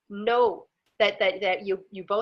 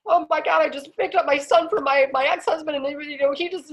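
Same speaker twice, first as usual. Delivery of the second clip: higher, worked-up mock rant; agitated venting; 4 semitones higher, 4 dB louder than usual. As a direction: imitate someone spilling out their grievance. Imitates upset woman's rant.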